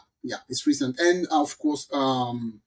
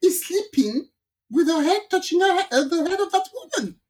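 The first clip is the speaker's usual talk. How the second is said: laughing disbelief; 10 semitones higher than usual. Real, not acted. Friend laughing while telling a friend the plane story. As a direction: voice raised high, laughing through it.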